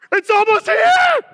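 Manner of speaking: very high, loud squeal of anticipation; excited shriek. Pretend. Preacher on Jesus' birthday almost here, excited shriek. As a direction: shriek it like an overexcited kid.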